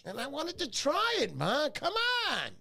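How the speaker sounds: high-pitched pleading with Ma; mock protest. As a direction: protest like a kid caught playing, pitch leaping up. Acts out protesting to his mother.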